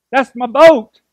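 a hint of Forrest Gump, loud and high; a light Forrest Gump drawl on the punchline; a slight Forrest Gump touch. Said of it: "Not sound like Forrest there".

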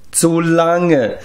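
high, drawn-out moan; groaning. A narrator's reading. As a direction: groan it out in misery. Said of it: "stöhnte Glev". (stöhnend)

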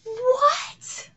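very high, rising squeal; stunned disbelief. Real, not acted. Still stunned by the reveal.

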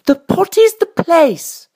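high, slow queenly pronouncement; high-voiced storybook queen. A narrator's reading. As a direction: declare it slowly in a high queen's voice. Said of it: "said the queen".

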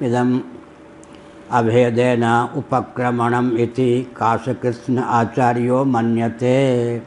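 slow, level chant of scripture; measured scholarly Sanskrit chanting; Sanskrit text recitation. Acted. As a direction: intone the Sanskrit evenly, drawing out the last word.

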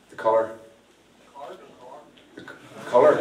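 colour, spelt out clearly for the crowd; stressed colour. Shown as a demonstration. Mentalist repeats colour for a confused volunteer, stressing it. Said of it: "Is it my accent?".

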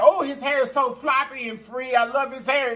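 imitating the lady's high gushing praise. Retelling the lady admiring Gucci's hair, high and gushing.

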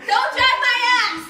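laughing, high-pitched protest; high yelped protest. Real, not acted. Partner being dragged across the room, yelping in protest. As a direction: yelp it out through laughter.

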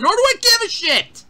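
sudden high-pitched dismissive cry; exasperated outburst. Real, not acted. Reviewer scorning lazy time travel writing, exasperated. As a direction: burst out, utterly fed up.

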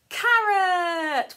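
high loud squeal of Carrot; puppet shout. Pretend. Sock puppet shouting the answer to children. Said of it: "can you guess at home?".

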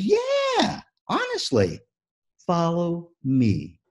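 very high, slow, coaxing whale voice; old Shamu coaxing. Acted. Old Shamu coaxes the new whale, high and slow. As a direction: draw the young one along gently.

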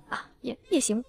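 stammering. A narrator's reading. Flustered old woman answers the face-mask offer, stammering. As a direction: stumble haltingly into agreeing. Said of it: "然后结结巴巴地说".

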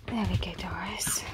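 soft whisper to a puppy; gentle whisper. Real, not acted. Trainer soothing Doris while giving pills, whispered. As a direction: whisper her through the pill.

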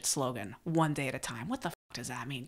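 lightly voiced dismissive inner critic; low, dismissive inner saboteur; scoffing saboteur. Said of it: "the saboteur will say".

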